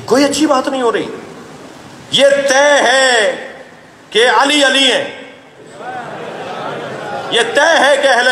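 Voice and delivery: slow, high, drawn-out proclamation, each word hammered; emphatic preaching. Real, not acted. Preacher to congregation on Ali's rank, slow emphatic proclamation. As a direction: declare it slowly like a settled verdict.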